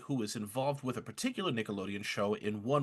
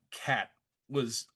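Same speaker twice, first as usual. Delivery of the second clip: normal talking voice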